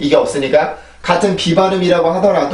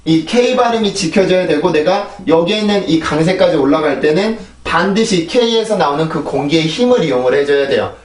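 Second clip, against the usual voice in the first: normal talking voice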